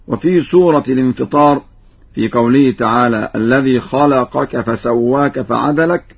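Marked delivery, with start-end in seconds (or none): none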